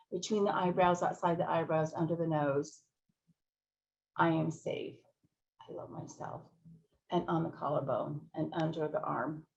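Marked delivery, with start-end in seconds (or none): 4.2-6.4 whispered affirmation. Shown as a demonstration. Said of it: "affirmation demo".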